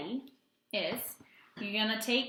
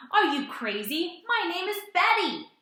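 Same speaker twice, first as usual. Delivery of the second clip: high, loud indignant monster; outraged storybook monster; furious; 8 semitones higher, 9 dB louder than usual. Acted. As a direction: snap back, offended. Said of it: "with a furious gaze".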